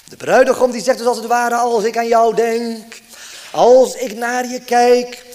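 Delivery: loud, high, fervent preaching voice; impassioned preaching. Acted. Preacher pressing the Lord's love on his congregation, fervent and loud. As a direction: preach it out with rising fervour.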